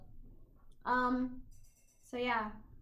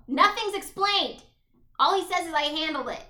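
loud, raised, exasperated complaint; exasperated rant; 4 semitones higher, 8 dB louder than usual. Real, not acted. Viewer fed up that nothing's explained, exasperated.

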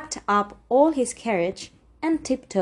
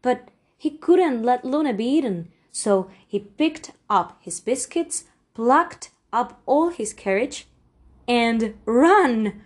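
ordinary delivery, nothing marked

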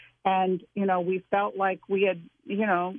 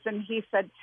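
ordinary delivery

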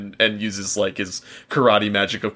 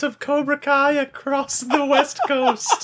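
high villain voice. Acted. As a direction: cackle out world domination. S1 joins the villain voice.

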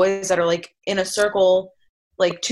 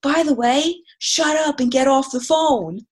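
rude stranger; 6 semitones higher than usual. Retold or imitated. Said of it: "And he goes".

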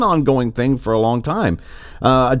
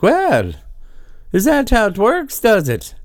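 a hammed-up Irish accent, much higher; mock Irish accent; 10 semitones higher than usual. Mocking the president's defence in a put-on Irish accent. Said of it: "I should have a pretty good Irish accent".

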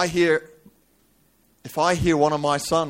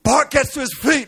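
high, raised preacher's cry; high-pitched dramatic sermon telling; impassioned preaching; 12 semitones higher, 5 dB louder than usual. Acted. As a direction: preach the story at full pitch. Preaches at full force.

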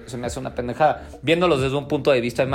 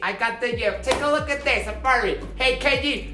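Adam Sandler impression; 10 semitones higher than usual. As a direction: pester him about the gem, pitched way up. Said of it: "todo el tiempo es Adam Sandler".

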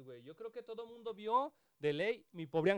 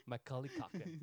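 lower, showy English-accented name; mock-fluent English accent; 4 semitones lower, 4 dB quieter, slower than usual. Shown as a demonstration. Showing off the actor's name to his friend, English accent. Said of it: "Ahí estás bien fluido".

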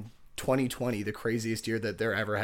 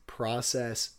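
ordinary talk

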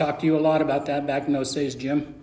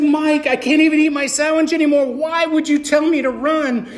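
higher, louder baffled plea; bewildered protest; 9 semitones higher, 5 dB louder than usual. Retold or imitated. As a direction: protest as if the advice makes no sense. Retold protest to his mentor.

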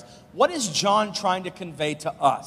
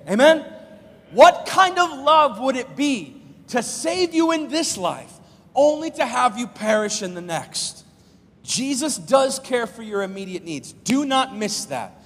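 loud call and answer pulpit voice; fervent sermon delivery; preaching at full force; 5 semitones higher, 6 dB louder than usual. Acted. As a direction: rouse the church. Preacher calls to congregation.

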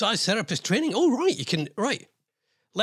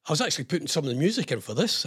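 ordinary talk, nothing marked

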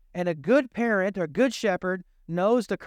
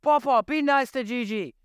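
grandson's voice; 6 semitones higher than usual. Retold or imitated. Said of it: "And he'll say".

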